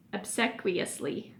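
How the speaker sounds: ordinary delivery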